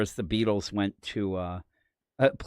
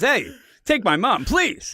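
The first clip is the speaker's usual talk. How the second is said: comedian quip; 10 semitones higher, 5 dB louder than usual. Retold or imitated. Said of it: "And I'd say".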